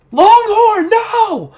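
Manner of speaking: very high, loud cry to a friend; horrified shout. Acted. Game master as Ocelot watching Longhorn fall, horrified shout. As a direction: scream the name in acted dismay. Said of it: "she's like".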